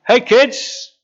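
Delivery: big cheerful hail from the beach; friendly call. Retold or imitated. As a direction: shout it across the water with a grin.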